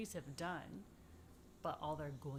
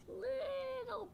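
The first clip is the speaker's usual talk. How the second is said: very high and very slow; high tiny storybook voice. Acted. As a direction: stretch it out in a teeny squeak. Voicing the tiny buds.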